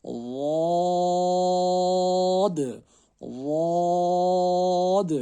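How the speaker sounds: واد chanted long and thick, high and loud; teacher modelling a thick ضاد; heavy stretched letter. Shown as a demonstration. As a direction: recite ضاد heavy, no ز sound. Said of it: "زواد کو بھی کھینچ کے".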